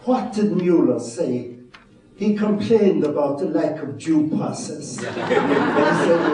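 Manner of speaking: normal talking voice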